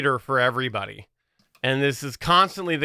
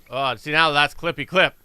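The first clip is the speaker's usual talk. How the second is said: normal talking voice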